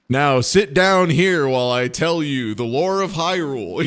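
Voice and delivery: put-on loud preachy old man; pompous lore-teller. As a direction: boom it like a lecturing elder. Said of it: "as opposed to being like".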